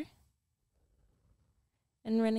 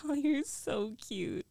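slow cooing whisper; 2 semitones higher, slower than usual. Acted. Cooing at boyfriend.

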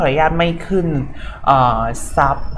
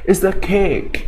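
"It's a cake" in a UK accent, higher; British accent; 5 semitones higher, much slower than usual. Shown as a demonstration. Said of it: "สำเนียงแบบ ยูเค".

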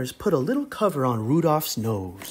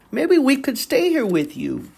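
put-on young Rudolph; 10 semitones higher than usual. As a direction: say it shyly like a young reindeer. Rudolph asks to stay.

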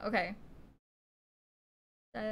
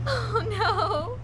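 laughing cry; 10 semitones higher, 7 dB louder than usual. Real, not acted. Laughs at own swearing.

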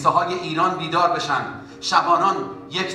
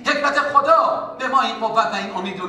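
fervent preaching; 6 semitones higher than usual. Acted. Preaching at full force.

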